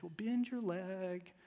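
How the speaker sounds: soft, slow, coaxing mother; gentle mother. Retold or imitated. Mom to her stuck son, very gently. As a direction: imitate a mom gently talking her child through it. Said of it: "very gently".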